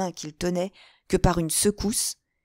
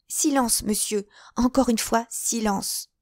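higher-pitched, insistent demand for silence; urgent hushing command; 5 semitones higher than usual. Acted. Young woman silencing her questioner, urgent. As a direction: cut him off, imploring silence.

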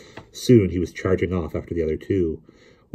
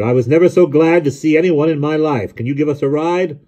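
loud, high-pitched shout of relief; roaring; 8 semitones higher, 7 dB louder than usual. A narrator's reading. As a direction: bellow gratefully up to the cab. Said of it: "Jonathan roared".

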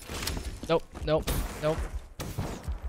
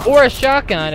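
sarcastic jab; 11 semitones higher, 10 dB louder than usual. Real, not acted. Sarcastic complaint about the sniper's threat.

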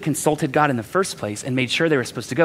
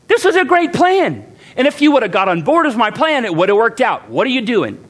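much higher, louder whining complaint; a pastor mocking his own blame of God, high and whiny; 6 semitones higher, 4 dB louder than usual. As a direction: whine at God like a spoiled child. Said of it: "guess who I blame?".

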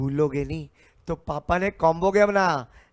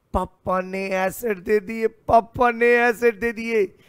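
excited boast; 5 semitones higher, 4 dB louder than usual. Acted. Debit boasting about papa's gift.

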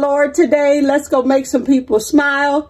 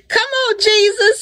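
high praise cry; 9 semitones higher than usual. Real, not acted. Host praises Jesus to viewers, crying out high. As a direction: Come on, Jesus cried out high.

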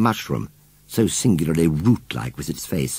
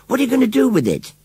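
quick, high-pitched demand; alarmed question; 4 dB louder, faster than usual. A narrator's reading. John demands to know the baby's fate, urgent. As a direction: rattle it out in alarm.

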